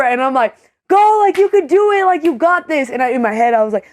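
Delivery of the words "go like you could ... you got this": high-pitched cheerleading, acting it out; retold pep talk with pitch way up; encouraging. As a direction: cheer a nervous kid on, high and eager.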